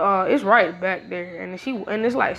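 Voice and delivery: ordinary delivery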